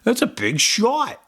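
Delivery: movie quote. Retold or imitated. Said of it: "Meet the Parents reference".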